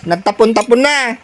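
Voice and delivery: ordinary talk, nothing marked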